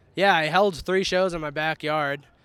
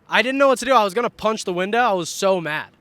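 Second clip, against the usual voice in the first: worked up; 4 semitones higher, 7 dB louder than usual. Real, not acted. Retelling his hot-dog fury.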